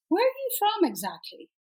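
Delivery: high-pitched mock puzzlement; imitated curious questioning. Retelling crew asking where she's from, mock-curious.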